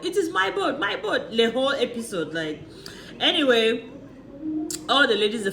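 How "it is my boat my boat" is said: high, insistent mock whine; mock insistence. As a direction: whine it like a spoiled owner. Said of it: "she was like".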